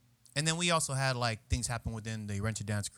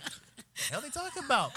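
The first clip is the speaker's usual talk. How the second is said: much higher incredulous voice; mock baffled self; 13 semitones higher than usual. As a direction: act confused at the complaint. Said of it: "I was like".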